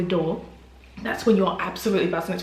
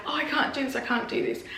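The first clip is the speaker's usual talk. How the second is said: distressed woman; 6 semitones higher, 4 dB quieter than usual. Retold or imitated. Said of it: "another lady who was like".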